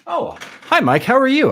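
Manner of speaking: high, delighted oh hi; pleasantly startled to see a friend; surprised greeting. Real, not acted.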